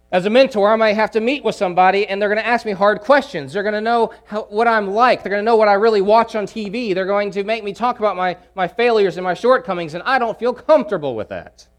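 a hint of nervous protest; anxious would-be mentor. Voicing a fearful would-be mentor.

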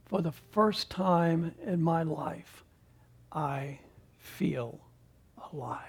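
slow, soft and sinking to a hush on alive; tender confession. Acted. Husband to his wife in bed, slow hushed tenderness. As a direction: let the last word melt away.

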